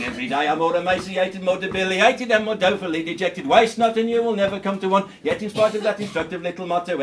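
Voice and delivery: a breathless patter run with laughs; fast patter again. Acted. Comedian racing the tricky verse a second time. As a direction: rattle the wordy verse off at speed, laughing. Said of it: "One more time".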